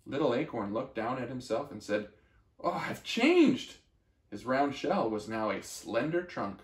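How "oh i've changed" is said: much higher, slow "Oh, I've changed"; amazed. Acted. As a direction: marvel at it, drawing it out. Acorn sees himself grown.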